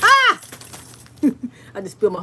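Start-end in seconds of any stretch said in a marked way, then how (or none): 0.0-0.4 sudden high loud scream; real shriek at a spill; startled scream. Real, not acted. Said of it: "I screamed in y'all ear".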